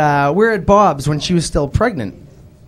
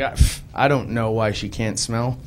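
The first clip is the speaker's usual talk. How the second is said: ordinary talk, nothing marked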